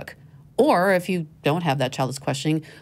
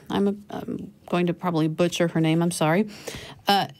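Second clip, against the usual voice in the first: usual speaking voice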